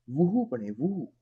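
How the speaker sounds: वुहू with the w sounded; mock wrong reading. Mock student reading who wrongly as वुहू. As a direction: imitate a child saying वुहू. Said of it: "तो आप हु क्यों पढ़ रहे हैं".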